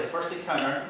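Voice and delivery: normal talking voice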